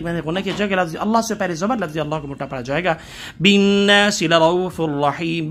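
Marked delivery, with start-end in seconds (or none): 3.4-5.5 slow, ghunna held on بِالنَّاسِ; slow demo recitation. Shown as a demonstration. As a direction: stretch the nasal nun in بِالنَّاسِ. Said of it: "بِالنَّاسِ پہ یہاں note کریں".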